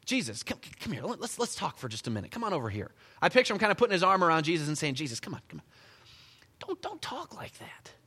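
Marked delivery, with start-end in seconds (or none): 0.1-2.9 lowered voice, pulling him aside; hushed, hesitant Peter, stumbling over his words; discreet aside. Acted. Peter takes Jesus aside privately. 4.9-7.9 near-whispered private plea; confidential, stammered urging under the breath; hushed pleading. Acted. Peter privately pleads with Jesus.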